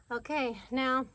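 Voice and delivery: usual speaking voice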